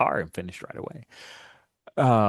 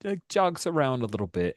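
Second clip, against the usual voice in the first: ordinary talk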